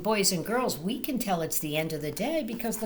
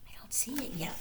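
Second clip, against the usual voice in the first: soft whispered aside; 2 semitones higher, 11 dB quieter, much faster than usual. Real, not acted. Reading to children, a soft whisper.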